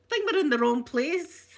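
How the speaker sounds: mock-worried newcomer, rising at the end; bewildered visitors. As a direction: imitate baffled visitors to Scotland. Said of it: "People land in Scotland go".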